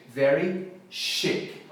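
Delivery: "very chic" stretched out slowly. Shown as a demonstration. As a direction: draw out "very chic" like a model. ("very chic" bem arrastado)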